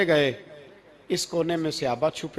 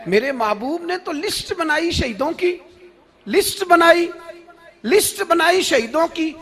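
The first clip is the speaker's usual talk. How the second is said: high, loud, fervent sermon cry; full-force preaching; 9 semitones higher, 6 dB louder than usual. Acted. Preacher telling the crowd of those who loved martyrdom, fervent shouting. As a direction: drive it out to the whole crowd at the top of the voice.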